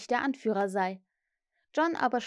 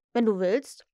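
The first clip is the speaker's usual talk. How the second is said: offhand, louder agreement; indifferent; 5 dB louder than usual. A narrator's reading. Peter agrees to teach the brothers, indifferent. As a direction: toss it off as if it doesn't matter. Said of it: "sagte er gleichgültig".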